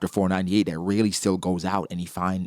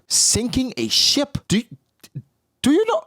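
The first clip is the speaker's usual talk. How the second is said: incredulous mockery; 3 dB louder than usual. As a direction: scoff at the idea of sinking a ship. Mocking Harold's threat.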